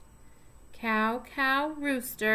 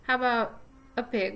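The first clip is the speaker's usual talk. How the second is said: usual speaking voice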